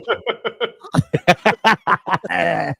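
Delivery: long, high-pitched real laughter; big laugh. Real, not acted. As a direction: crack up at your own story. Cracks up after his story.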